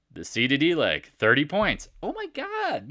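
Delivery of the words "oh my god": high-pitched fake astonishment; mock shock. Trader mocking shock that algorithms control the market, feigned astonishment. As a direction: gasp it in pretend disbelief.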